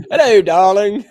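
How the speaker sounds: high, loud British "Hello, darling" through laughter; mock British accent. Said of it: "mimic her British accent".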